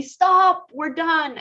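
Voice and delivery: high, loud mock yell; imitated yell. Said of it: "would, like, yell at me".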